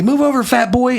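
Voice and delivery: high mock voice of a sassy old woman; imitating old lady. Old lady on a scooter passing him, high cheeky taunt. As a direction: toss it off like a cheeky eighty-year-old. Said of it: "I heard her say".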